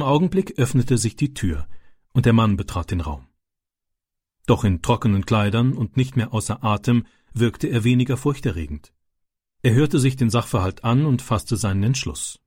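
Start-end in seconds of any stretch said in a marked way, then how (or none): none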